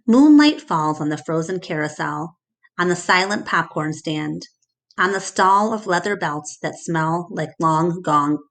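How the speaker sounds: ordinary delivery, nothing marked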